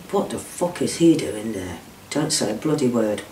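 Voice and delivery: low, soft menacing murmur; hushed threat. Retold or imitated. Abuser's hushed threat retold.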